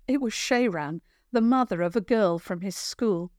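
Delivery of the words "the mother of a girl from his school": usual speaking voice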